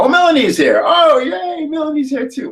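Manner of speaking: high-pitched delighted shout; excited squeal. Real, not acted. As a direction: squeal with joy as a friend arrives. Host spots Melanie joining call.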